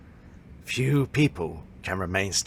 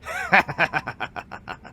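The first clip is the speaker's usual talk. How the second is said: amused laugh; 6 semitones higher than usual. Acted. Master amused by pupil's shock.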